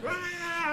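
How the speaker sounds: mock scream. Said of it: "He's like".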